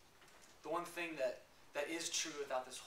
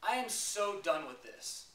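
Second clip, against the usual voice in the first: yelling at God; 7 semitones higher, 7 dB louder than usual. Retold or imitated. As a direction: cry out at heaven in anger, full force. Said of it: "I started yelling at God".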